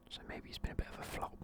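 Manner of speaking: ordinary talk, nothing marked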